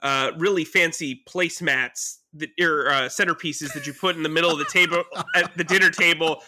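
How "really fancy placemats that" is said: ordinary talk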